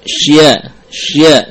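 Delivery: "Sya" said as sh-ya, a Y slipped in; inserted Y. Shown as a demonstration. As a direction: slip a Y glide into each "Sya". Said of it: "menyisipkan ya". ("Sya" dengan sisipan bunyi ya)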